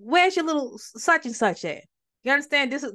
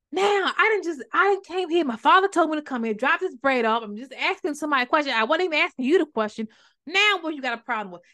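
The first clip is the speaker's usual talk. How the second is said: higher-pitched, insistent self-defence; acted protest; 6 semitones higher, 3 dB louder than usual. David defending himself to his brother, higher-pitched indignant protest. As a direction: pile up the objections, exasperated.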